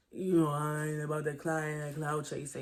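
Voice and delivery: low, hushed imitation of his denial; quiet, low mocking quote. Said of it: "He gonna say he not a clout chaser".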